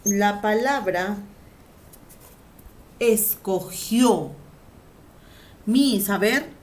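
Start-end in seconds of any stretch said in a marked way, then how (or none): 3.0-4.3 "escogió" stretched slowly, syllable by syllable. Shown as a demonstration. Teacher splitting an example word for students, slow syllabic "escogió". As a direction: draw out "escogió", landing firmly on the final "ó".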